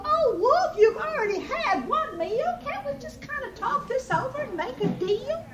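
very high piglet squeal; pleading squeal. A narrator's reading. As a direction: beg for your life in a squeaky pig voice. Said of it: "began to squeal".

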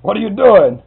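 loud, high booming demand. Retold or imitated. Bank president booms at the intruder, booming shout. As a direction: boom it out across a big room. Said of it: "heard this voice boom out".

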